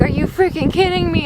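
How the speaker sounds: high-pitched disbelieving protest; retold exasperation. Traveler finding gates at the bathrooms, exasperated.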